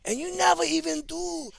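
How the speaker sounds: high-pitched Pidgin-voiced complaint; upset local guy. Comedian imitating an upset local fan, high and pleading. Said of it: "this one guy go".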